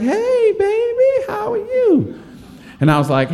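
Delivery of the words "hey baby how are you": high, sing-song auntie hello; sweet auntie greeting. Retold or imitated. Aunties upstairs greet the boy in costume, high cooing voice. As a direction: coo at the kid like a doting aunt.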